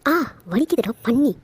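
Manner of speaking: loud, indignant cry of pain; pained yelp. Acted. Sister protests at brother's pinch, loud pained yelp.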